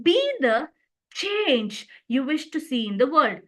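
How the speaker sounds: short pause, then CHANGE stressed. Shown as a demonstration. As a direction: hold back before change, then land on it. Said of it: "the stress is laid on the word change".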